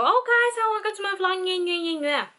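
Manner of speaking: high, bright-eyed vlogger intro, mocking herself. Vlogger mocking her own intro voice, high and cheerful.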